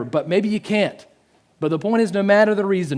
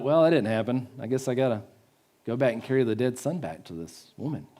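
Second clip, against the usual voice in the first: softer, lower mock resignation; resigned giving up; 4 semitones lower, 6 dB quieter than usual. Prophet imagined carrying the dead son back, resigned. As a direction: let it drop away, half-hearted.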